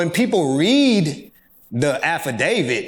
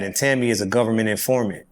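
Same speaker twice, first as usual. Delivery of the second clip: ordinary talk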